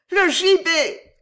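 slow, high, loud cry of terror; panicked scream. A narrator's reading. Saizuma recoiling from the remembered gallows, screaming. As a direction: cry out in horror, drawing it out. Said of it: "hurla Saizuma en reculant affolé".

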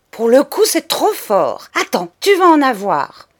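loud, high outraged threat; the cousin at the end of her patience; menace furieuse. Acted. (menace de raclée, outrée et forte)

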